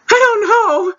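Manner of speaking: high, lost little voice; clueless reply. Retold or imitated. Unsure what to tell the waiter, high and lost. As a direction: sound totally lost and out of your depth. Confused at fancy water menu.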